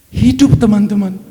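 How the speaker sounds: preacher telling the congregation the man lived, loud and triumphant; triumphant proclamation. Real, not acted. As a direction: announce the miracle like a victory. Triumphant climax of healing testimony.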